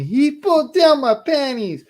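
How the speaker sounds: put-on high, small child's voice, upset; little sister's voice. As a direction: tattle like a hurt little girl.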